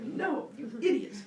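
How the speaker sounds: high, slow exasperated telling-off; regaño exasperado, alto y lento; exasperated scolding. Acted. Prometheus scolds humans.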